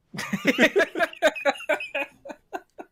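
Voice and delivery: loud, high burst of laughter; real helpless laughing; big laugh. Real, not acted. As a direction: crack up in a long, high giggling fit. Cracks up at own sexy remark.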